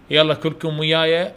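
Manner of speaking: ordinary talk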